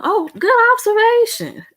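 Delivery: sudden high squeal of surprise; pleasantly surprised. Real, not acted. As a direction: pitch jumps way up, delighted by the comment. Reacting to a viewer comment.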